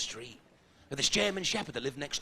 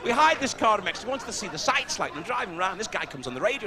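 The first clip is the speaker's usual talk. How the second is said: normal talking voice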